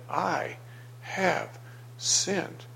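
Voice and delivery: soft, halting admission of guilt; head-hung confession. Acted. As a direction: say it word by word, quietly, head hung. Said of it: "He hung his head".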